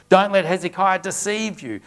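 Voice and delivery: scripture read as an enemy's loud, bold taunt. Acted. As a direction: call it up to the wall with swagger. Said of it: "louder and with more boldness".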